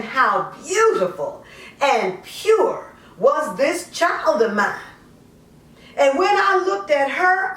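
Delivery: lightly voiced mother justifying herself, conversational; poem in character. A mother explains giving up her daughter, lightly in character. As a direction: speak it as the mother, half confessing, half defending.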